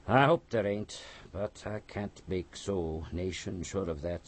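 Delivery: usual speaking voice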